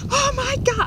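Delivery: high, loud mock shriek; a hand touches something slimy in the drain; retold scream. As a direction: squeal it in fright. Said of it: "I, like, screamed".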